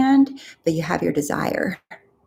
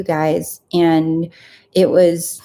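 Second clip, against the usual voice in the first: usual speaking voice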